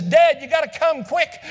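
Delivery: put-on urgent child call. Child calling dad again, urgent.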